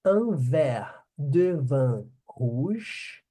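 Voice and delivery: very slow model sentence for repeating; slow demo. Shown as a demonstration. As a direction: say it slowly so they can repeat. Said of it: "Quero ouvir vocês pronunciando".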